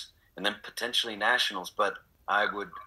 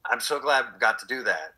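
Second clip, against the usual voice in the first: ordinary talk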